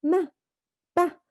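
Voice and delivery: 한 음절씩 끊어 아기처럼; 아기가 따라 하듯 "마, 빠"; baby imitating. Shown as a demonstration. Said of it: "막 이렇게 소리를 냅니다". (아기 소리 흉내)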